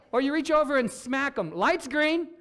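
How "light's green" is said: blunt nudge. Pretend. Smacking the driver.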